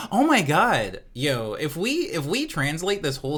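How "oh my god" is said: loud, high oh my god; loud shocked gasp. Real, not acted. Streamer shocked by a lyric, loud and high. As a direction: burst out in shock.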